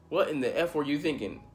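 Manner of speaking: angry coach. Retold or imitated. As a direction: imitate the coach chewing him out. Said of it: "he was pissed about it".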